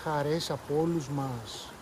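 put-on quiet wondering girl, slowed down; thoughtful. A narrator's reading. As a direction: muse it aloud, slowly. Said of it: "είπε σκεπτική".

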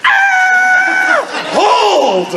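high startled yell of disgust; sudden scream to stop the game; startled shout. Retold or imitated. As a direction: shriek and call a halt. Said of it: "And she went".

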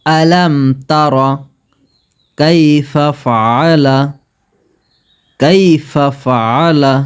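measured recited Arabic phrases; recitation drill. Shown as a demonstration. Teacher reciting for students to repeat, measured. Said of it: "আমার সঙ্গে সঙ্গে পাঠ করবেন".

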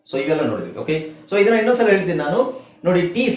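normal talking voice

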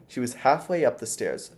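ordinary delivery, nothing marked

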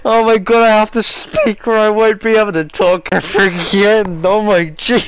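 choking on laughter, fighting to get words out. Real, not acted. Gamer choking with laughter after the fall, squeaky and loud. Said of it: "I choked just then and it made me sound like Mickey Mouse".

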